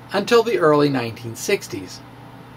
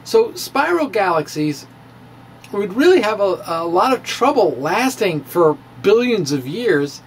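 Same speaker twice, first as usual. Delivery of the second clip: ordinary talk, nothing marked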